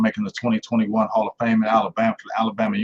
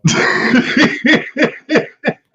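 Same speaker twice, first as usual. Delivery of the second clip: high-pitched burst of giggles; real laughter; 5 dB louder than usual. Real, not acted. Host cracking up at the player's cut story, real laughter. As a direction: crack up helplessly, high and long.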